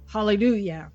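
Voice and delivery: ordinary talk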